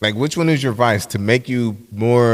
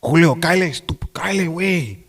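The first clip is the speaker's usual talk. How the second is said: Theo's voice; 5 semitones higher than usual. Retold or imitated. Nephew Theo calling him in Spanish, lightly voiced. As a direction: call him over in lightly put-on Spanish. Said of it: "in his eyes, I'm Mexican".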